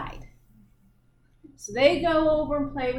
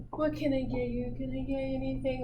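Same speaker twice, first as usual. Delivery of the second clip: soft husband offer; 8 dB quieter, faster than usual. Retold or imitated. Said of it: "Honey comes over, loving it".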